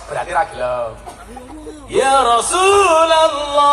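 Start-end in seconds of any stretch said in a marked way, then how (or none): 1.9-3.7 long, loud, high drawn-out call. Retold or imitated. Husband hailing his wife from the doorway, drawn-out shout. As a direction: holler it slowly from the bedroom door. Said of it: "langsung teriak dari depan".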